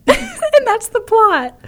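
high, slow squeal breaking into laughter; laughing disbelief. Real, not acted. Host sums up absurd plot for co-host, laughing incredulously. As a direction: crack up at how absurd it is.

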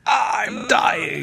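high strained dying cry; dying man. Retold or imitated. A dying man gasping his last words, high and strained. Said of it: "It was just".

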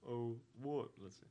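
usual speaking voice

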